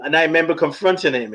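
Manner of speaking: ordinary talk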